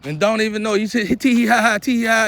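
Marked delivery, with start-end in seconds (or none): 1.1-2.2 high, louder mock tittering "tee hee ha"; mocking giggle. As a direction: giggle like a cruel bully, hammed-up. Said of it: "you laughing at crippled people".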